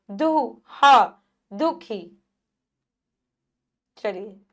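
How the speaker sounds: ordinary talk, nothing marked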